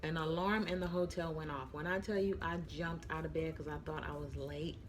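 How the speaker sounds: ordinary talk, nothing marked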